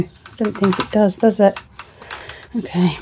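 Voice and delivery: normal talking voice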